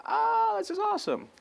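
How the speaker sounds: very high delighted oh; thrilled. Retold or imitated. New mother thrilled by the [name] CD, high delighted. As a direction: squeal with delight at the gift.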